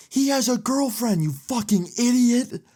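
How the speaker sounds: imitated yell. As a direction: imitate an angry boyfriend yelling across a bar. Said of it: "I hear him yell".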